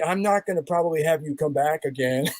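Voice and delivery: a hint of a weary director; lightly voiced director. Director firing him.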